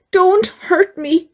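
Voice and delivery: high, pleading whimper; mock-scared high whimper; whimpering lion. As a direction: beg not to be hurt. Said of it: "he whimpered".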